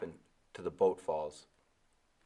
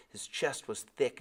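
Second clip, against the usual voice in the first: plain reading voice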